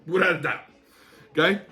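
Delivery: normal talking voice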